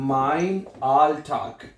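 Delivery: drawn-out syllables, very slow; slow dictation. Shown as a demonstration. Teacher slowly dictating the German phrase again. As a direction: dictate slowly so students can write. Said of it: "जुमला बनाएंगे".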